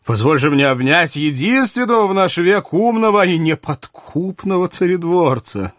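high, effusive royal praise; theatrical high-voiced flattery; admiring king. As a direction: gush over the courtier as a monarch. Narrator voices King Louis.

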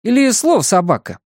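high, quick businesslike query. A narrator's reading. Pat checks a symbol of the key, high and businesslike. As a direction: pipe up high and brisk, checking a detail. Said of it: "деловито спрашивает Пат".

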